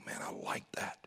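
soft whisper; hushed, private approval; whispered reaction. Acted. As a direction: breathe it out under your breath. Listener's inner response to God.

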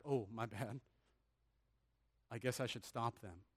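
low, soft half-hearted apology; acted meek concession, trailing quietly; mock sheepish. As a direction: back down meekly, as a joke. Imagined sheepish Jesus backing down.

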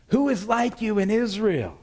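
high, slow, loud holler; chiding yell. Acted. David chides the king's bodyguard from afar, yelling. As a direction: call it out mockingly over a great distance. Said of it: "And David yells".

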